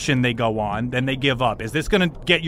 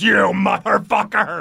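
drawn-out, higher-pitched curse; angry outburst; 6 semitones higher, 4 dB louder, slower than usual. Acted. Pally rounds on the one who sobered him, angry. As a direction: turn on them with a slow, furious swear.

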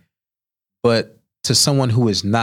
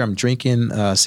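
usual speaking voice

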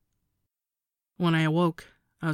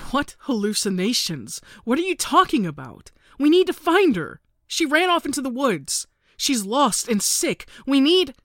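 rising, louder desperate insistence; desperate pleading; 9 semitones higher, 4 dB louder than usual. A narrator's reading. Narrator begging mother to find lost sister, desperate. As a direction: climb in pitch and volume, frantic.

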